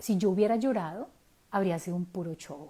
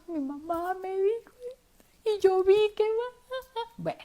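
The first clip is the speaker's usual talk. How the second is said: crying while talking; 10 semitones higher than usual. Real, not acted. Breaks down retelling the pain.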